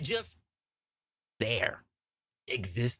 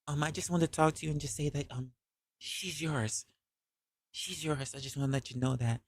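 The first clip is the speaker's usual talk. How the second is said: much softer, hushed reveal; quiet confession; 7 dB quieter than usual. Retold or imitated. Ex quietly telling him the child is his. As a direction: drop your voice to break the news.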